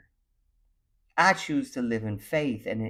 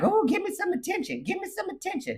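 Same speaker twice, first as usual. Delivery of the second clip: much higher, needy whining; whiny plea; faster than usual. Retold or imitated. Pleading with busy partner for attention, whining. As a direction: beg like a neglected child. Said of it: "here I am like".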